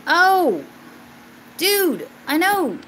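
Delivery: sudden high excited yelp; real excitement breaking through; excited outburst. Real, not acted. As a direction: burst out excited at the news. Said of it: "That got me excited".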